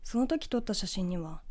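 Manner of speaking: plain reading voice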